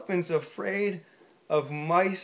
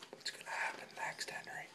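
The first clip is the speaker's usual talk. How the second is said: whispering; 20 dB quieter, faster than usual. Real, not acted. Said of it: "unvoiced aside".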